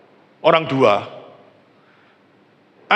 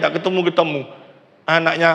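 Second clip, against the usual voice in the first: normal talking voice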